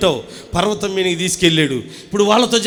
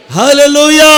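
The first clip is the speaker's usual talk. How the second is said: Hallelujah shouted out loud and high; loud hallelujah; 8 semitones higher, 9 dB louder than usual. Shown as a demonstration. Preacher leads the congregation in a loud shouted hallelujah. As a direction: belt out hallelujah to lead the crowd. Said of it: "చెప్పాలి గట్టిగా".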